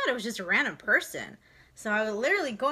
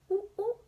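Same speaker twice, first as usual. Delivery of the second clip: monkey noises; 11 semitones higher than usual. Pretend. Monkey sound for toddler.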